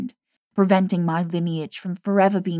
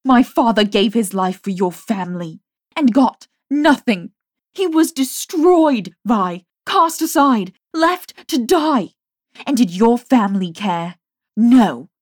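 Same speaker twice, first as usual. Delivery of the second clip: furious; 3 semitones higher, 7 dB louder than usual. A narrator's reading. Jamie's furious tirade.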